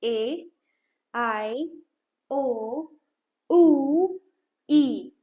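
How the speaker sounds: each vowel said alone, clearly; vowel sounds demo. Shown as a demonstration. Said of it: "ए sound कैसे pronounce करने हैं?". (स्वर अलग-अलग साफ़ बोलें)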